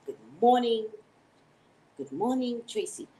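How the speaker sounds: "Good morning" swooping up high on MORNING; trying out intonations. Shown as a demonstration. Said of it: "I gotta get the right intonation".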